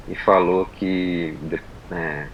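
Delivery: normal talking voice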